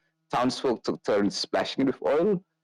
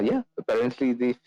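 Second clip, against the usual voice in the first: normal talking voice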